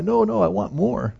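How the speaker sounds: high, drawn-out pleading; mock longing cry, pitched well up; imitated heartfelt cry. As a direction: plead for it not to end. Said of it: "it was her heart's cry".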